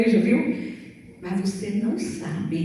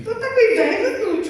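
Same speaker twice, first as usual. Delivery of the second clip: high, loud, teasing through laughter; laughing daughter; 11 semitones higher, 6 dB louder than usual. Retold or imitated. Daughter catches her mother envious, laughing high and loud. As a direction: tease mom while laughing. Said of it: "Ela falou assim".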